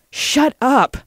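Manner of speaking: high, loud outburst; angry shout. Acted. Lena silences the taunting lobbyist, shouting shrilly high. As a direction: shout him down, voice jumping up.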